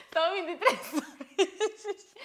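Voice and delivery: high, loud, slow words through laughter; laughing. Real, not acted. Host confesses how late the recording is, laughing hard. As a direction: struggle to speak while cracking up.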